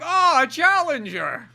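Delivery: high, loud, drawn-out cry; mock announcer's excitement. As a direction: hail a new fighter, hammed-up. Fight crowd greeting challenger, acted.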